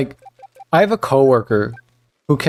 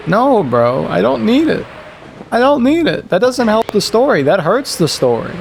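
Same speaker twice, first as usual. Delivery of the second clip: higher, worked-up refusal; exasperated; 9 semitones higher than usual. Real, not acted. Telling the coworker off, high and fed up. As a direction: push back hard, fed up.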